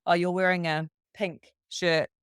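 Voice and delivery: ordinary talk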